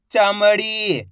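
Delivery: चमड़ी read out with a long ई; drawn-out long ई. Shown as a demonstration. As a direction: stretch the final ड़ी of चमड़ी. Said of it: "खींच के पढ़ा जाता है".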